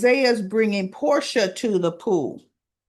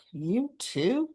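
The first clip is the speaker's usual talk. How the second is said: softer, mock incredulous; lightly imitated disbelief; incredulous you too; 2 semitones higher, 6 dB quieter than usual. Portia learning Isaiah dated Jordan, mock incredulous. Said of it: "she just looks at him like".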